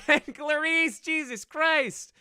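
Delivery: high, loud, slow shriek of surprise; real stunned exclamation, high and loud; shocked. Real, not acted. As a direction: gasp out the surprise at the donation. Reacting to big donation.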